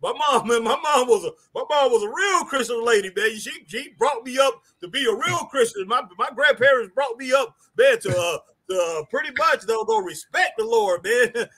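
ordinary talk, nothing marked

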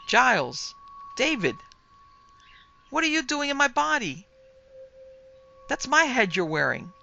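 high, loud indignant outburst; outraged shout. A narrator's reading. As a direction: shout in disbelief at the thief. Outraged head shouts at body thief.

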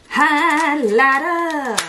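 drawn-out announcement. Acted.

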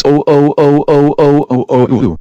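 furious shouted oh oh oh; angry yelling. Acted. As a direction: yell it out in outrage. Parent keeps ranting.

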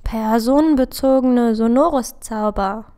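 loud, sudden burst of inspiration; Sirius, sudden exclamation. A narrator's reading. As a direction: call out the idea with force. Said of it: "rief Sirius plötzlich aus". (plötzlicher, lauter Ausruf)

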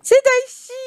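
high, loud burst of joy; excited shout. Real, not acted. Greeting the long-awaited butler, excited shout. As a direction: cry out his name in delight.

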